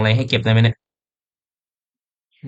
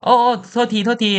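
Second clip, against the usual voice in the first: higher, urgent hushing; alarmed character voice; 9 semitones higher than usual. Acted. Ashley apologizes. (เสียงสูงรีบห้ามไม่ให้พูดดัง)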